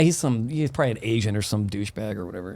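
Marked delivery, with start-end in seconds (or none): none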